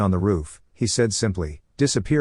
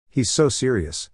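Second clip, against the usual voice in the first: plain reading voice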